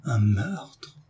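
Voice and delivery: aghast, without raising the volume; hushed cry of horror on a rising tune; shocked exclamation. A narrator's reading. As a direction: recoil in disbelief. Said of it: "s'écria David en pâlissant".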